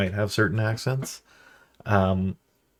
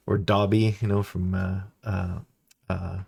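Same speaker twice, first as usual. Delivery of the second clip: ordinary talk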